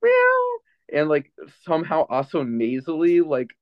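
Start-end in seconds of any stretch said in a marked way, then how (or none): 0.0-0.5 very high squeaky meow; cat meow. Acted. The cat answering its owner with a very high meow.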